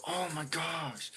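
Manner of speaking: slow, high drawn-out imitated dismay; dismayed gasp. Roommate seeing the bare rotor, slow high dismay. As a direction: stretch it out high, stunned. Roommate sees the missing tire.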